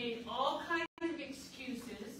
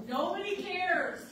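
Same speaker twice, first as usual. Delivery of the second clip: loud, raised-pitch outburst; loud, high, then falling at the end; 5 semitones higher, 5 dB louder than usual. Retold or imitated. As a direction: call it out loudly over a meeting. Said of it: "So I said very loudly".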